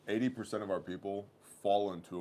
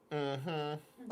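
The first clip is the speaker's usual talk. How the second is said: higher, drawn-out doubting hum; skeptical clerk mm-hmm; 8 semitones higher than usual. Retold or imitated. Clerk peering over her glasses, skeptical humming. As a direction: hum like she doesn't believe a word. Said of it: "lowers her glasses, looks at me and goes".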